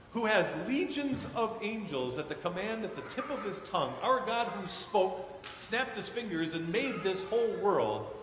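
preaching. Acted. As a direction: proclaim God's greatness to the congregation.

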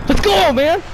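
excited cheer. Real, not acted. Cheering a kill.

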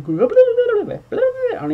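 high loud slow babble imitation; mock gibberish yelling. Mocking noisy neighbors, high gibberish yell.

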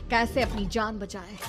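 plain reading voice

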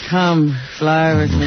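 slow, drowsy late-night voice; Allison Steele, sleepy. Retold or imitated. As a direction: croon it out half asleep. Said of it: "No wonder she sounded a little sleepy".

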